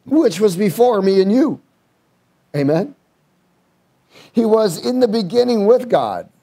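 fervent scripture reading; preaching. Acted. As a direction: proclaim the verse.

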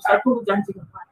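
low, soft prayer to oneself; inward prayer. Retold or imitated. Patient silently telling God she waits, inward prayer. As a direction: say it quietly, as if inside the heart. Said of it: "Dalam hati dia bikin itu".

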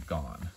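Gone said once, clearly, as the letter name; teacher says Gone as the model. Shown as a demonstration. Teacher modelling the letter name Gone for learners. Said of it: "that's the pronunciation".

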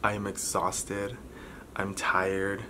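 usual speaking voice

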